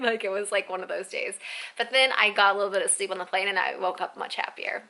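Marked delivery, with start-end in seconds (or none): none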